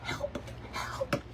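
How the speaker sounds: hushed whisper to a friend. Retold or imitated. Whispering to friend Michelle for help. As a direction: whisper it so nobody hears. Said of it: "I quietly tell her".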